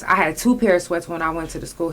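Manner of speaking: usual speaking voice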